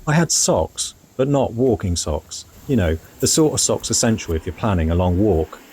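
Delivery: calm, measured travel voiceover; voiceover narration. A narrator's reading. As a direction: tell it like a reflective travel diary.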